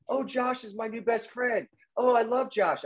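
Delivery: high, drawn-out mock adoration; imitating gushing friend. Host mimics Bradford on his new best friend, gushing. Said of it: "all I heard about you was from Bradford".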